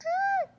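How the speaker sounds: very high shriek; a sudden high shriek of play fright; mock scream. Pretend attack. (صرخة حادة جدًا من خوف تمثيلي)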